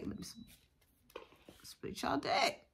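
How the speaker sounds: hushed mystic whisper over the cards; whispered ritual. Pretend. Ritual call to split deck.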